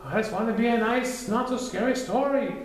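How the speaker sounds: very high, urgent plea; pleading Piglet. Acted. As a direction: beg them to stop.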